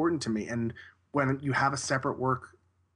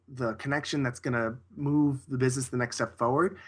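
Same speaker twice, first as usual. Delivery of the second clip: ordinary talk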